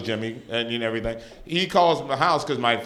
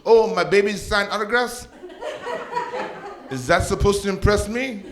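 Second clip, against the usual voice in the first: mocking mom; 7 semitones higher than usual. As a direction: scoff at him from up high. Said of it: "She goes".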